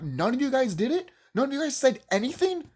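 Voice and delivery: high, disbelieving questions; incredulous. Real, not acted. Vlogger confronts parents' silence, shocked and incredulous.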